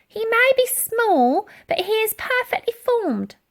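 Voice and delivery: put-on an offended old dear standing up for her man; prim old lady. A narrator's reading. Dotty defends her love.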